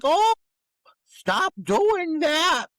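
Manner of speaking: high, very slow limp protest; mocking Petey. Mocking Petey's weak protest at the bullies, high and slow. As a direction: drag out a feeble high objection.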